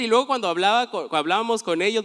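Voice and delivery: ordinary talk, nothing marked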